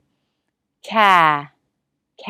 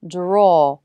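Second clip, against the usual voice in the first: "draw" stretched slowly, lips rounded on aw; slow drawn-out draw. Shown as a demonstration. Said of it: "the tongue is further back and the lips round more".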